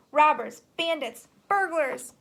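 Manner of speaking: the rat shrieks after the fleeing foxes, loud and high; furious shrieks. A narrator's reading. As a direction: shriek each word after them. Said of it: "shrieked the rat".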